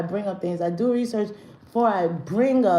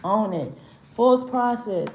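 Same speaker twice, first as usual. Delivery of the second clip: ordinary delivery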